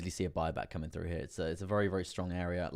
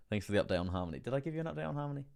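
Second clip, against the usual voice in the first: ordinary talk, nothing marked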